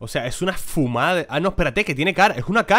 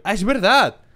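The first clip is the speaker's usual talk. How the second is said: higher, louder dawning ah; sudden penny-drop delight, pitched up; amazed realization; 8 semitones higher, 6 dB louder, slower than usual. Real, not acted. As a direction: it really is, wow. Realizing again, more amazed.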